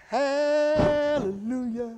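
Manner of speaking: slow, high praise; joyful preacher praising God; drawn-out hallelujah. Real, not acted. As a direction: savour the word. Preacher rejoicing.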